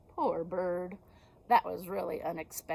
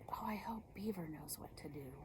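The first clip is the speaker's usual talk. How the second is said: a soft unvoiced hope, much softer; hushed aside; 13 dB quieter, faster than usual. A narrator's reading. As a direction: whisper to the children. Narrator's hushed aside to kids.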